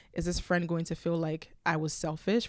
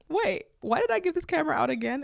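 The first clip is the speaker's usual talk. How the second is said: higher, louder thought of dismay; startled realization; 10 semitones higher, 3 dB louder than usual. Retold or imitated. Recalling the camera she gave away, startled and higher. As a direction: catch yourself mid-regret. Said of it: "I thought to myself".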